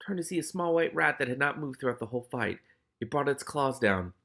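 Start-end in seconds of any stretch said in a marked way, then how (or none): none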